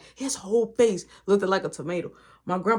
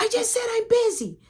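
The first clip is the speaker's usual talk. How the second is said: put-on whiny kid voice. Little brother snapping from his room.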